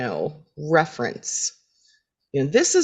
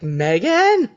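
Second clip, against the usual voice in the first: higher, louder questioning call; searching call; 5 semitones higher, 5 dB louder than usual. Retold or imitated. Felicity looking for Megan, searching call. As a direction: call out for a missing roommate.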